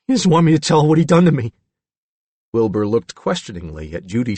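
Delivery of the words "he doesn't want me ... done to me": high, loud, rapid confession; anxious, fast character voice; frightened Billy. A narrator's reading. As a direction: hurry it out like a scared boy. Billy fears his father.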